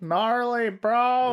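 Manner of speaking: high-pitched mock stoner bro; hammed-up surfer-dude voice; West Coast stoner. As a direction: say it like a cartoon stoner. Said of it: "that West Coast stonery vibe".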